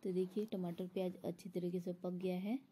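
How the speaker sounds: ordinary delivery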